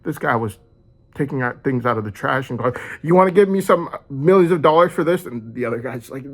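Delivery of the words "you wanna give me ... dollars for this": higher, louder hammed-up sales pitch; mock salesman pitch. The father offering trash for millions, hammed-up pitch. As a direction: pitch the trash like a pushy seller. Voicing the man selling trash.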